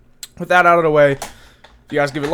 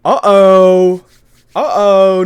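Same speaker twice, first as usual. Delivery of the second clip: singsong uh-oh; 5 semitones higher, 11 dB louder, much slower than usual. Retold or imitated. Robin teasing about new bounties.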